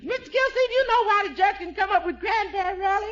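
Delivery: eager repetition. Acted.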